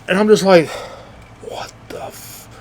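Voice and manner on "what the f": slow, whispered disbelief; stunned whisper. Acted. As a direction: whisper it, cut off mid-word. Stunned reaction to a shower scene.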